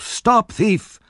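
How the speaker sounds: high-pitched narrated cry of alarm. A narrator's reading. Old gentleman chasing Oliver, crying out. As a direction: call it out high, as if after a runaway. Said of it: "With loud cries of".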